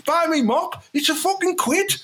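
very high mock sales pitch; blunt mop seller. Mop maker addressing advert viewers, very high blunt pitch. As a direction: push the voice right up, selling bluntly. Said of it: "Just go".

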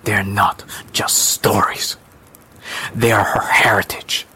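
slow, breathy resolve; resolute. Acted. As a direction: state it slowly, standing firm. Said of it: "The woman was resolute".

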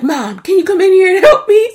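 mock a grown man calling his mom; mock whiny son. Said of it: "Then he's like".

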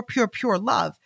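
ordinary delivery

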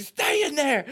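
high, strained order to the pets; frantic order. Retold or imitated. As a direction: order them to stay put, voice shooting up.

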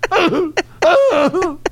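very high, laughing-crying shout; mock crying plea. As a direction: wail for help, half laughing.

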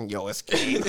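a light put-on Skeet voice with a hint of Irish. Asked by a friend, a light Skeet impression. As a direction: do your Skeet voice, slightly Irish. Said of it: "I gotta hear your Skeet voice".

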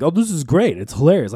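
high, loud retold delight; mock-giddy burst of enthusiasm; imitating excited grandfather. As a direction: gush like a thrilled grandpa. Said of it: "he was, like, actually excited about this phenomenon".

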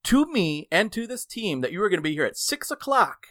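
loud, high indignant telling-off; retold yelling. Worker confronts late regional manager, angrily indignant. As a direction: scold the boss for breaking a commitment. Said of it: "yelled at anybody".

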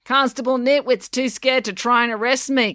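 high, projected retort; called out. A narrator's reading. Former mayor's wife answering Brad, calling out. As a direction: call back across the crowd. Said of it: "called the former mayor's wife".

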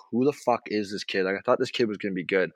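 low, muttered coach aside under the breath; a hushed, doubting coach; imitating worried coach. The coach mutters about the kid, hushed. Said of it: "He's like".

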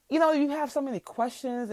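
usual speaking voice

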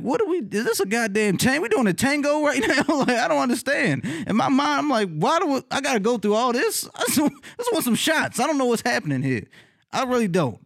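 high-pitched baffled complaint; bewildered protest. Retold or imitated. Retelling own bewildered inner protest.

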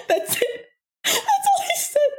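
high, helpless laughing speech; barely able to talk for laughing; cracking up. Real, not acted. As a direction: squeeze the words out through laughter. Cracking up at coworker's "Whoa.

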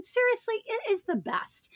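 high and soft on "it is the best"; sincere aside. Real, not acted. First take, then repeated with emphasis.

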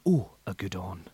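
low, smooth, soft commentator voice; Andrew Cotter impression. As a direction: murmur it like a calm dog commentary. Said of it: "I could do an Andrew Cotter".

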